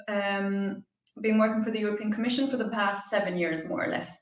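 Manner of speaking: ordinary talk, nothing marked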